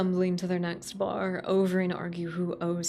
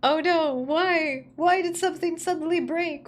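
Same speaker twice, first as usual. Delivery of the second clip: high startled cry; sudden panic at a glitch; real alarm; 9 semitones higher, 4 dB louder than usual. Real, not acted. Streamer panics over glitch.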